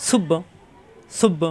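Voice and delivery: clipped doubled b in সুব্ব; সুব্ব said with a short doubled b; demo of correct Arabic. Shown as a demonstration. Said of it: "হা কণ্ঠনালীর মাঝখানে চাপ দিয়ে". (সুব্ব উচ্চারণ, দ্বিত্ব ব)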